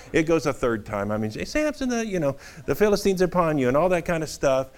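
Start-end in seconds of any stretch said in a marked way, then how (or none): 1.3-3.7 high, fast cartoon cry of alarm; cartoonish Delilah. Delilah calling Samson a third time, high cartoon squeak. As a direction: rattle off the warning in a high squeak.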